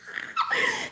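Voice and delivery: a sudden very high mock giggle; imitating boss's laugh. Streamer mimics boss's attention-seeking laugh, high mock giggle. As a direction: squeal a fake laugh to get noticed. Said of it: "he'll be like".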